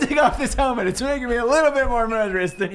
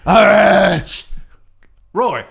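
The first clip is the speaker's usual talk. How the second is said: gruff all right; 8 dB louder, much slower than usual. Acted. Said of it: "I just go".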